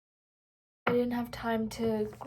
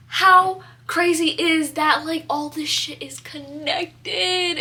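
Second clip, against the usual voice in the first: much louder and slow, almost tearful; real thrilled amazement, nearly in tears; overjoyed disbelief; 4 semitones higher, 8 dB louder, slower than usual. Real, not acted. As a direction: stretch it out in stunned delight. Amazed that her readings connect.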